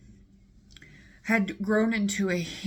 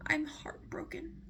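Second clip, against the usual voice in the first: higher, whispered melodramatic sigh; mock heartbroken sigh; 9 semitones higher, 6 dB quieter than usual. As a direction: sigh it like a wounded diva. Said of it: "She's like".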